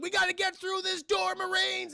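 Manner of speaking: high, strained rallying cry; urgent acted call. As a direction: urge the squad through a door. Acts Marines urgently at door.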